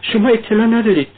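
high-pitched frantic calling; acted hysterical outcry; distraught cry. A narrator's reading. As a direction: cry out in agitation, pitched well up. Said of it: "کاترین فریاد زد".

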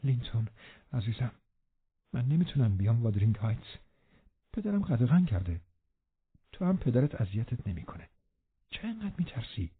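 hushed, soft confiding voice; murmuring. A narrator's reading. Catherine softly asks her frightened cousin why, murmuring. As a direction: murmur it gently, fading almost to a whisper. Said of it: "کاترین نجوا کرد".

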